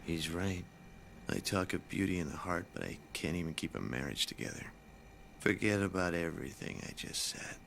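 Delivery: quiet, low and defeated; deflated. Real, not acted. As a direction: let the air go out of him. Teacher deflated after wife remark.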